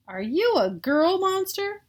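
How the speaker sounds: put-on high-pitched child asking in wonder; wary kid question. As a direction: ask it like a wide-eyed little boy. Child asks the monster.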